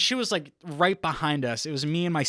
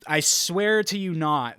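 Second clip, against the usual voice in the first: usual speaking voice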